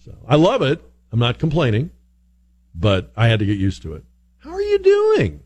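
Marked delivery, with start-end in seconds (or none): 4.4-5.4 earnest greeting. Retold or imitated. Host mimicking strangers' greeting, high and earnest. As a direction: sing it out like a friendly stranger. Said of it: "said with that emphasis".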